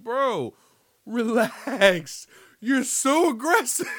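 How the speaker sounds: cracking up while telling him to calm down; exasperated laughing outburst. Retold or imitated. Host reacting to the stalker character, laughing high and loud.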